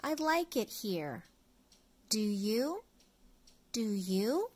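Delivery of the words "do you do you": usual speaking voice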